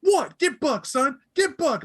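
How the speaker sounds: high, heated street challenge; hyped gang taunt. Acted. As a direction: goad him into a fight. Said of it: "He goes".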